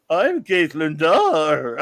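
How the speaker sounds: loud, very slow mock introduction; hammed-up audiobook narrator imitation; George Takei. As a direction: draw the name way out theatrically. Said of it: "I can't even do it".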